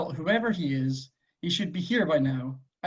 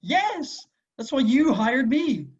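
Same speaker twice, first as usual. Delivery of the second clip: a sudden much higher yell of disbelief, then louder; exasperated outburst; 8 semitones higher, 4 dB louder, slower than usual. Acted. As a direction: blurt it out, baffled that he even asks. Confused assassins argue in standoff.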